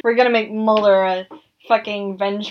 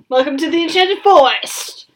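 put-on Australian accent; 6 semitones higher than usual. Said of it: "However you do an Australian accent".

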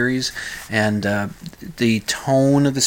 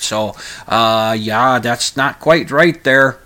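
hammed-up Minnesota accent, loud at the start; 4 dB louder than usual. Host telling his wife about local liquor laws, Minnesota accent. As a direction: put on an insistent Minnesota accent. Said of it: "break out the Minnesota accent".

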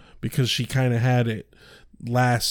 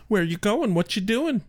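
mock nagging mom, lightly pitched up; higher-pitched prying questions, like an imitated mom; nosy mom type; 8 semitones higher than usual. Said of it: "being the mom type and be like".

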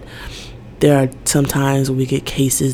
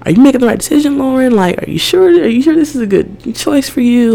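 much higher, anxious self-questioning voice; nagging inner doubt, pitched up; 11 semitones higher than usual. Retold or imitated. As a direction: question yourself in a high worried voice. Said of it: "And so it's like".

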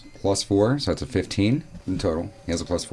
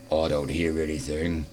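dull giant voice; 4 semitones lower, 5 dB quieter than usual. Acted. Second giant answers flatly.

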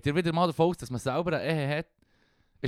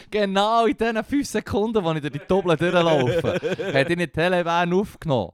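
laughing; 6 semitones higher, 7 dB louder than usual. Real, not acted. Laughing while telling the story.

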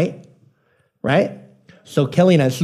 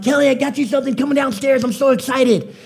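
excited; 9 semitones higher than usual. Retold or imitated. Husband bursts in excited.

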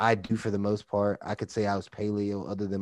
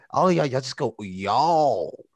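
Texas y'all; 4 semitones higher, 5 dB louder than usual. Shown as a demonstration. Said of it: "I just love Texas accents".

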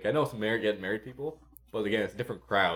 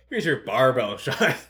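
laughing mock; 3 semitones higher, 6 dB louder than usual. Bartender mocking the misheard order.

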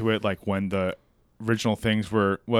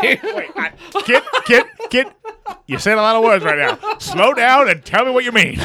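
laughing; 7 semitones higher than usual. Real, not acted. Laughing exasperated interruption.

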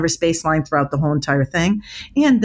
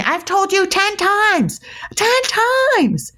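much higher, slow exasperated mock yell; mock scream; slower than usual. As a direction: wail it like a fed-up parent. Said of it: "because you're screaming".

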